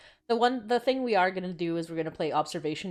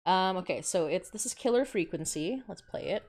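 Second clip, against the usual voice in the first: usual speaking voice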